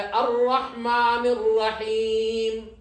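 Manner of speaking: very slow, melodic chanted verse; Quran recitation. Shown as a demonstration. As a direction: draw out each word in tajwid chant.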